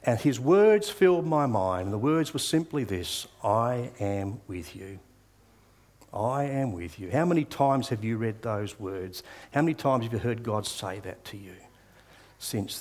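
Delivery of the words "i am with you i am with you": slow and quiet, very gentle; God comforting the heartbroken young man, slow and soft; tender divine reassurance. Retold or imitated. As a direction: say it softly, like comfort at dawn. God's words quoted softly.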